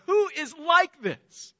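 high, slow cry of wonder; awestruck exclamation. Acted. Preacher beholding Christ at Christmas, awestruck. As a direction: stretch the question out in amazement. Cries out the worship response.

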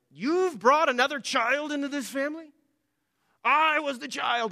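put-on indignant child's protest, pitched high; exceedingly displeased. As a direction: act out an outraged jealous firstborn, stretching the last words. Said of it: "they may get very exceedingly displeased".

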